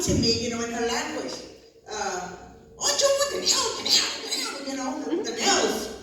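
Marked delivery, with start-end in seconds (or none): 2.8-4.5 high, loud mock voice of the grouchy manicurist; a mock grouchy manicurist repeating the nail; imitating the nail lady. 5.2-5.9 higher mock voice with a laugh; laughing while playing the manicurist; a touch of the manicurist's voice, laughing; imitating the nail lady.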